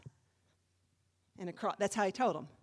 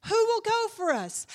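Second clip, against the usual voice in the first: high, loud cry falling at the end; heightened preached call; 11 semitones higher, 8 dB louder than usual. Acted. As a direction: cry the question out to the crowd. Second, heightened take of call.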